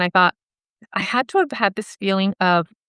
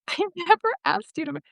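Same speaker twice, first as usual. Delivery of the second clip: high, softer imitation of his denial; retold flustered denial; 10 semitones higher, 5 dB quieter than usual. Boyfriend insisting he never asked, high and flustered. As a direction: squeak out the denial, cut short.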